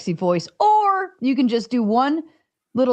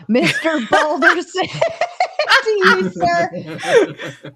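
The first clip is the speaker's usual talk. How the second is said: words broken by laughter; slow, giggling through the words; laughing while talking; 9 semitones higher, 3 dB louder, much slower than usual. Real, not acted.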